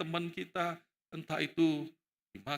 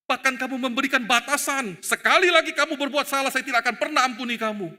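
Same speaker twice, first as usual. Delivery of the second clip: loud, raised, insistent accusation; forceful rebuke; 5 semitones higher, 7 dB louder than usual. Acted. Jesus confronting Peter over his limits, forceful rebuke. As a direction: hammer it out loudly.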